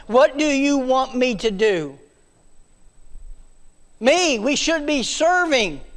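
high, loud, ringing preacher voice; preaching at full force. Acted. Preacher rousing the congregation to serve, loud and high. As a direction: preach it out to the back pews.

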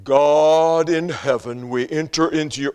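slow, booming pious prayer voice; mocking a pastor's churchy prayer voice, slow and loud; put-on pulpit prayer voice. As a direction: intone it like the organ's stuck in your throat. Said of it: "changing the sound of your voice".

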